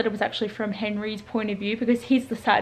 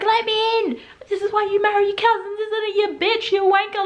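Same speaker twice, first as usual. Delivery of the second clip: mock angry rant; 11 semitones higher than usual. Retelling the rain scene, mock angry yelling. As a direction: rant at him through the door, high-pitched. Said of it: "Like, he was so angry".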